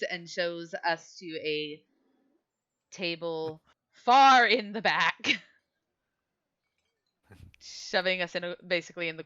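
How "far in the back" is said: FAR drawn out, loud and high; stress on far. A narrator's reading. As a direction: stretch "far" out loudly to show the distance. Shows them to a distant corner table.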